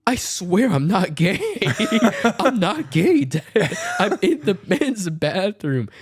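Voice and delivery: laughing plea. Retold or imitated. Begging dad on the phone while laughing, high-pitched. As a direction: laugh helplessly while insisting.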